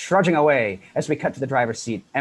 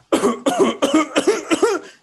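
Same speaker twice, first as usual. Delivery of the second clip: loud hammy cough; fake cough; 7 dB louder than usual. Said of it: "coughing keyboard".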